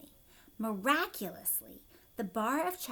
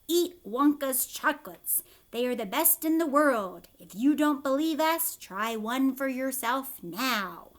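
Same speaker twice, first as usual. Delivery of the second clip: commercial voice; 6 dB louder than usual. Acted. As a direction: sell it like a TV ad. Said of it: "the voice will say".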